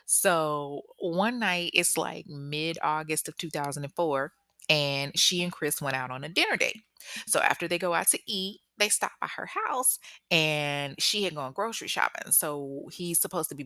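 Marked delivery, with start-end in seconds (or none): none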